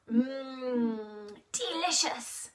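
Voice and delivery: soft, quiet savouring hum; pretend yum. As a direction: savour the pretend hot chocolate. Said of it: "pretend to drink some hot chocolate".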